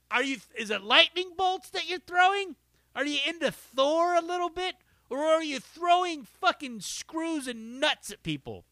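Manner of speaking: high, loud mocking questions. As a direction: taunt them in a high voice.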